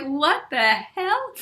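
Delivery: slow, high-pitched disbelief; incredulous. Retold or imitated. Speaker retelling a surprise invitation to talk on prosperity, incredulous. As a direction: stretch it out, retelling your own shock.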